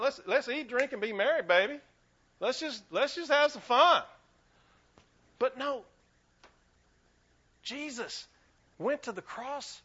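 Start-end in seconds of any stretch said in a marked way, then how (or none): 0.0-4.0 loud, higher, breezy party-goer voice; mock carefree partier. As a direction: toss it off like someone who just wants a good time. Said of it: "And so I'm like".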